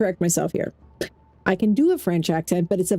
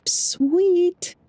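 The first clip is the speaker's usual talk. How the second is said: high, drawn-out delight; 11 semitones higher than usual. Real, not acted. Gamer finding a legendary musket, high delighted reaction. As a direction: savour the great find, pitched way up.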